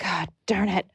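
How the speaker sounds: soft grumbled God darn it; muttered curse. Retold or imitated. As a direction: mutter it under your breath. Frustrated in the snow.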